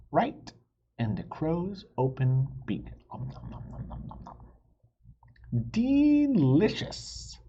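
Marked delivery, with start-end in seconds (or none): 3.1-4.4 fast whispered nom nom; munching noises. Acted. Crow eats the cheese, fast munching sounds. 5.7-7.4 high, slow, drawn-out delicious; savouring crow. Acted. Crow savours the cheese, slow and high. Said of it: "she said".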